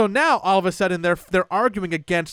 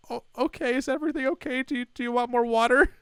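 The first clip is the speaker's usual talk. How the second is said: higher, softer, hesitant mock-nervous voice; nervous waitress; 7 semitones higher, 4 dB quieter, faster than usual. Spooked waitress offering water to the table, mock nervous. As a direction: imitate a rattled, timid server.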